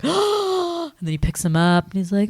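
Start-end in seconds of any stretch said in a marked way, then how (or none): 0.0-0.9 touched aww. Retold or imitated. Crowd touched reaction.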